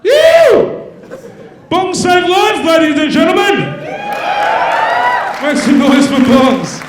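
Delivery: shouted way up high, hammed-up rally cry; crowd hype shout. Comedian rouses the audience for bongs, big hammed-up shout. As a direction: whip the room into cheering.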